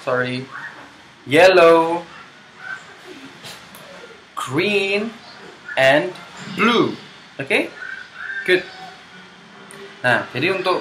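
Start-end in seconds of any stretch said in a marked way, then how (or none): 0.1-7.0 teacher drill. Shown as a demonstration. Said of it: "Saya ulangi".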